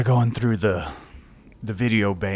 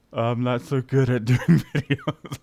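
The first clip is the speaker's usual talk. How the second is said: laughing. Real, not acted. Laughing at old videos.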